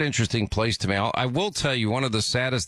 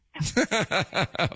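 high, rapid chuckle; real amused laughter; 7 semitones higher than usual. Real, not acted. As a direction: burst out in a run of high chuckles. Host amused by caller's plea.

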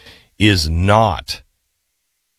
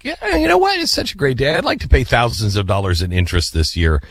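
mock cheerful debtor; 2 semitones higher than usual. Host mimicking someone happy to pay credit card interest, mock cheerful. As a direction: sarcastically play someone delighted to pay interest.

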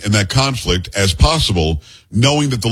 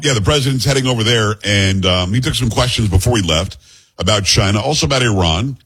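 ordinary delivery

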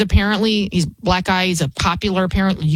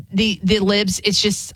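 normal talking voice

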